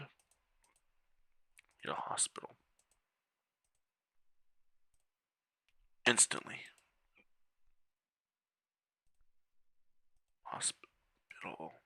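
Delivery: breathy muttered whisper. Real, not acted. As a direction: whisper it to yourself. Muttering to self while clicking.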